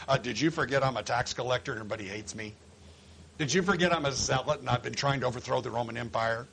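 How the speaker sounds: low, quick, deadpan objection; dry, hurried protest; sceptical. Acted. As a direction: rattle off the doubt in a low voice. Acting sceptical apostles listing objections.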